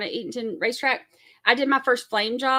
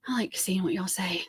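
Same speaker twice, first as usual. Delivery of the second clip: soft whisper; 5 dB quieter than usual. Real, not acted. Whispers while reading chat.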